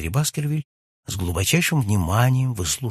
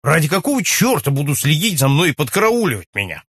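loud, higher-pitched exasperated question; irritated, raised voice; indignant outburst; 5 semitones higher, 5 dB louder than usual. A narrator's reading. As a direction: demand it loudly, in exasperation. Guest's indignant outburst after exclaiming.

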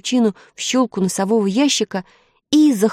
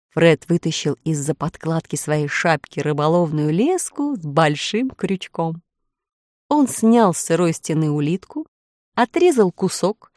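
ordinary delivery, nothing marked

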